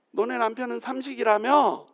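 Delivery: high-pitched needling question; mocking taunt. Boastful wife needling another woman about her husband, mocking. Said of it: "남편을 막 조롱을 하는".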